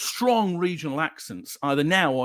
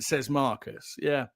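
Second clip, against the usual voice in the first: ordinary talk, nothing marked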